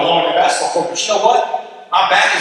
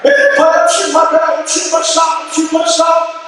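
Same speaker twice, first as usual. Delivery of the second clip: defiant insistence; 9 semitones higher than usual. Retold or imitated. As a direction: stand your ground, pitch climbing and insistent. He insists on his shot.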